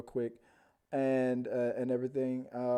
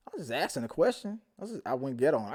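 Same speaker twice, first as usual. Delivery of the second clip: ordinary talk, nothing marked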